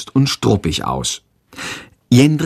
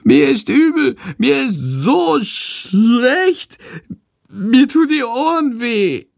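high, drawn-out sickly wail; high-pitched, agonised whimpering; whining; 12 semitones higher, slower than usual. A narrator's reading. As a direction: moan miserably in a squeaky creature voice. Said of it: "Jetzt jammert Shandor".

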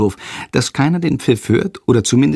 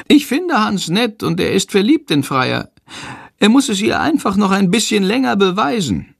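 high, chirpy creature voice; high talking bird defending Hans; Shandor's voice; 10 semitones higher than usual. A narrator's reading. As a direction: play the little bird, earnest.

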